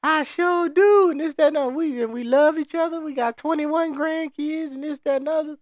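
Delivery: churchgoing lady telling off the speaker, mock high old lady voice; old church lady.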